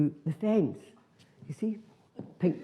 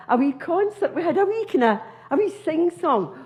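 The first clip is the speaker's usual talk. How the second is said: high chirpy; 11 semitones higher than usual. Acted. High chirpy voice for sing-song.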